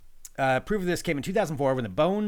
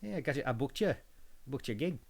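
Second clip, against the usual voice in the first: put-on New Zealand accent; 5 dB quieter than usual. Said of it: "That's more [name]".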